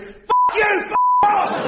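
high hammed-up telephone abuse; mock angry shout. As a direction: snap it like a furious stranger. Voicemail or mugger shouting at David.

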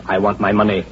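ordinary talk

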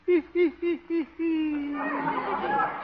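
soft, tittering giggle; coquettish titter. Acted. Sweetheart giving a present before leaving, flirty titter. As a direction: titter in little quick bursts.

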